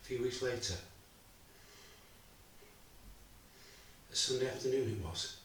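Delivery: quiet, measured, heavy with loss; a father recalling betrayal and his wife's death; grieving father's monologue. Acted. A father telling the audience of loss, subdued and grieving. Actor performs Bob's final monologue.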